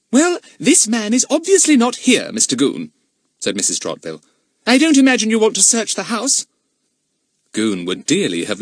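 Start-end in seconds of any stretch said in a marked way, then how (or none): 0.1-6.5 high, loud stern lady; haughty mistress of the house. Acted. Narrator voices Mrs Trotville.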